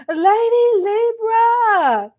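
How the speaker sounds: "Lady Libra" sung out high and slow, stretched like a fond cry; drawn-out call. Acted. Shout-out call to a viewer.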